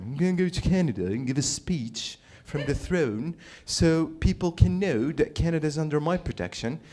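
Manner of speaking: hammed-up royal English accent; King's British accent. King addressing Canada from the throne, mock British accent. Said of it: "let me do it in his accent".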